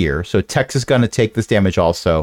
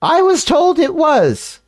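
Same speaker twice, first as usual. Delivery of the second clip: character voice. Acted.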